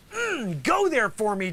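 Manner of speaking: ordinary delivery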